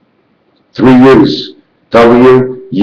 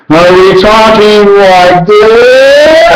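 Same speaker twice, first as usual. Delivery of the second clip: slow, drawn-out and higher; a slow, lifted demo of how we talk; 7 semitones higher, much slower than usual. Shown as a demonstration. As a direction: stretch every word out, pitched up. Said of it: "It's our talking and how we talk".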